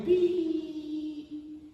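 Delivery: long high monotone beep; imitated monitor beep. Preacher imitating the little machine beside the stretchers, sustained beep. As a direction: hold one high tone like a heart monitor.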